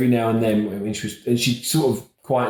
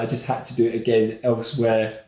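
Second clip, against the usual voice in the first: ordinary delivery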